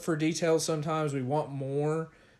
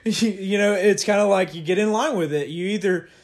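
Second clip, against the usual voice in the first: laughing through words; 5 semitones higher, 8 dB louder than usual. Real, not acted. Laughing while explaining.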